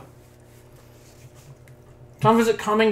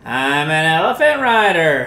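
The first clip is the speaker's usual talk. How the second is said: silly put-on voice; 2 semitones lower, 5 dB louder than usual. Silly voice bit.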